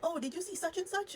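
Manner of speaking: lightly voiced chirpy mom; high mom voice. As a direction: mimic the mother's casual sing-song question. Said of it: "was like".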